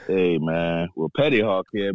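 ordinary talk

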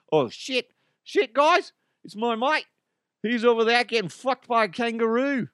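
high mock Australian accent; hammed-up Australian mate. Shown as a demonstration. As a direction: report it as an alarmed cartoon Aussie. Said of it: "my Australian voice".

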